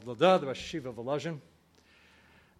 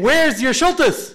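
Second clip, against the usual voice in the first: higher and louder, demanding angel; mock angel screaming; 9 semitones higher, 10 dB louder, slower than usual. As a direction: bellow the question like an accusing angel. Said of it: "the Malach would have started screaming".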